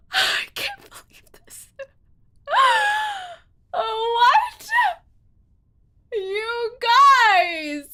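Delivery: high-pitched gasping disbelief; aghast. Real, not acted. Player learns it was a fake-out, aghast. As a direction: cry it out high between gasps.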